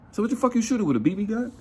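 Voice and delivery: high mocking disbelief; incredulous. Incredulous at shooting.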